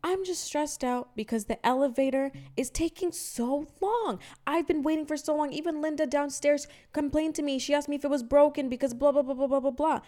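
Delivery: put-on high whiny old lady voice; hammed-up neighbor, fretful and high; mock apologetic old lady. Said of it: "and she's like".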